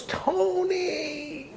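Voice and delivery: high, drawn-out call of "Tony"; called name. Retold or imitated. Maggie calls Tony from a streetcar, high drawn call. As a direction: sing out the name across the street.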